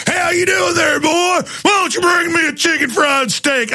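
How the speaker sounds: high, hollered East Texas twang; mock East Texas accent. Customer calling to an East Texas waiter, condescending mock Texan accent. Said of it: "some East Texas people's restaurant, we're like".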